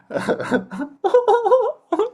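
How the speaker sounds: very high loud cartoon laugh; cartoon giggle. Actor does his character's iconic laugh, very high. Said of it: "I love cheeky Wayne".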